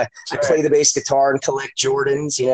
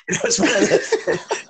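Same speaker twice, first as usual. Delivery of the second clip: laughing. Real, not acted. Laughing at ex's comment.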